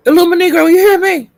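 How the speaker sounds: high, loud calling out; excited call-out. Real, not acted. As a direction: call out across the room. Calling out to viewer.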